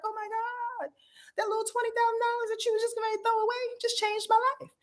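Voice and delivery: high, gushing voice of an overwhelmed employee; grateful gushing person. Retold or imitated. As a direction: play the stunned worker thanking the boss. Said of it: "people are like".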